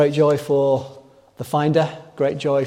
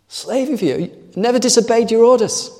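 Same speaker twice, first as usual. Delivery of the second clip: higher and rising, aggrieved protest; indignant older brother; 8 semitones higher than usual. Acted. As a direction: push the complaint up to a peak. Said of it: "He summarizes his very impressive record".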